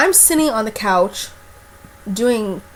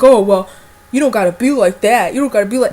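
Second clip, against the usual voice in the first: guy's retort; 7 dB louder than usual. Retold or imitated. Man's retort after threat.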